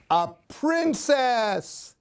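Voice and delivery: ordinary delivery, nothing marked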